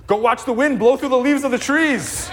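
a high, hammed-up call to the kids to go outside; mock nagging parent. Pastor mimicking herself telling her teenagers, high and laughing. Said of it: "I tell my two teenagers".

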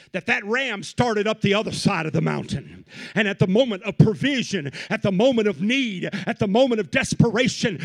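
loud rising sermon cadence; preaching at full force. Real, not acted. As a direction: preach it out, building.

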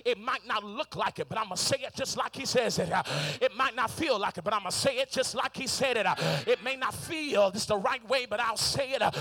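loud, driving sermon climax; preacher urging the congregation to speak it aloud; preaching at full force. Acted. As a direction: push each line harder to the crowd.

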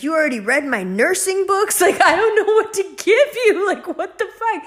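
high, loud, laughing in disbelief; exasperated mother laughing at her bookworm kid; laughing exasperation. Retold or imitated. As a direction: mom cracking up, out of ideas. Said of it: "she's like".

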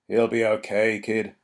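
muffled behind a helmet mask. A narrator's reading. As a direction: speak as if through a visor. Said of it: "said the man in a muffled voice".

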